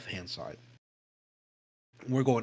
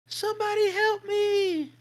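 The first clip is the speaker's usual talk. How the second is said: very high, slow, drawn-out eerie cry; 4 dB louder, slower than usual. Retold or imitated. Storyteller mimicking the voice calling from the woods, very high eerie cry. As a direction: imitate a strange voice calling from the dark woods.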